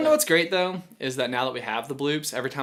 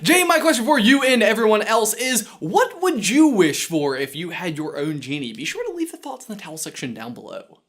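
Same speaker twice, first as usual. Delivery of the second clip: starts high and loud, then eases; punchy host outro read; presenter sign-off; 5 semitones higher, 5 dB louder than usual. Acted. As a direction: address the viewers with bright energy. Reads scripted sign-off question.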